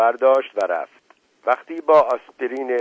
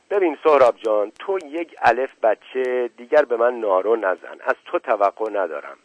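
ordinary delivery

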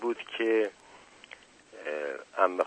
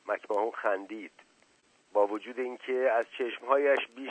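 measured storytelling with lightly acted dialogue; audiobook-style reading, lively on the dialogue; story narration; 3 semitones higher, 6 dB louder than usual. A narrator's reading. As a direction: read a novel aloud to listeners. Reads the novel aloud.

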